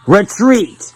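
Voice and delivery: high, loud yelled command; one furious, high-pitched yell; angry scream. A narrator's reading. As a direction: scream the order in anger. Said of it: "screaming in anger as he yells".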